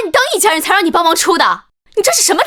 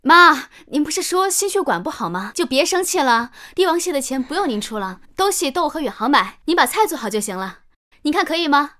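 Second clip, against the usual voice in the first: sweet-talking; 6 semitones lower, 4 dB quieter than usual. Acted. Daughter-in-law coaxing again.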